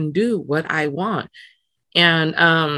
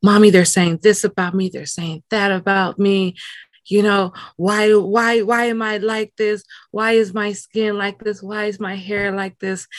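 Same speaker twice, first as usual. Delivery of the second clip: put-on higher, plaintive little-kid voice; upset child; 5 semitones higher than usual. Hurt daughter asking mom why she looks like this, upset. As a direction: plead like a hurt child, lightly acted. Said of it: "I came home crying, and I went to my mom".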